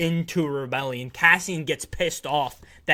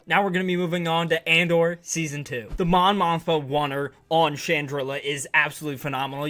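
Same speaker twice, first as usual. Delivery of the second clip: normal talking voice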